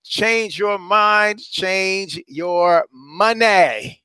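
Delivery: slow, drawn-out slogan delivery. Shown as a demonstration. Coach reciting his slogan slowly to listeners. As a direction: stretch each word like a catchphrase.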